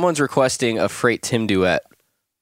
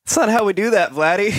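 high, chiding sing-song; mock scolding; 11 semitones higher than usual. Fan correcting player who tagged his location, high mock scolding. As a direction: gently tell off a naive friend in a high voice. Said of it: "I was like".

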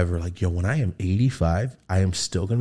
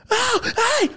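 startled yelp. Retold or imitated. Startled outburst.

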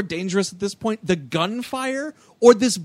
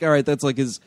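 normal talking voice

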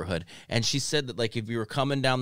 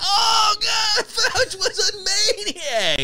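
high, slow laughing yell; laughing shout; 3 dB louder, much slower than usual. Pretend. Cohost joining the Fauci bit, laughing and shouting. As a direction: crack up while shouting it.

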